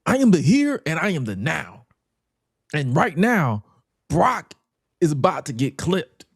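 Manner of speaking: mock the promo, high and grand; hyped promo voice. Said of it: "he didn't say it like that".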